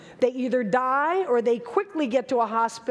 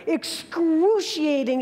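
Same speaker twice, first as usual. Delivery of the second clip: "excruciating" stretched slowly, a bit higher; drawn-out excruciating; 3 semitones higher, slower than usual. Retold or imitated. Recalling the sting to the audience, a slow, drawn-out word. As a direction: let "excruciating" linger slowly. Describing the jellyfish sting pain.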